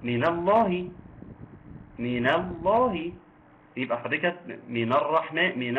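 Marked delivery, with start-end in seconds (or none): none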